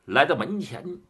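usual speaking voice

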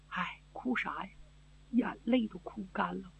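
very soft, drained and hopeless; no tears left to cry; weary grief. Acted. Grieving princess answering her husband, softly drained.

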